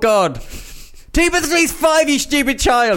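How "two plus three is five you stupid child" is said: high, loud exasperated snap; wicked stepmother. Acted. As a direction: lose patience and scold the child. Teacher snaps at child.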